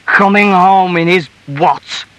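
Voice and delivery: loud, high, slow echo hurled back; drunken husband's outraged shout; indignant shout. A narrator's reading. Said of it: "shouted her husband".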